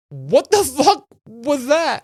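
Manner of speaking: slow, high stunned yelp; shocked. Retold or imitated. Tased drunk friend, stunned and shocked. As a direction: sound like you just got tased.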